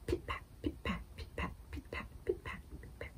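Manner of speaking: whispered raindrops dying away to nothing; fading whispered rain. Acted. Librarian's rain sound effect, fading whisper. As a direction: let the rain fade into a whisper.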